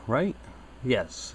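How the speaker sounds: normal talking voice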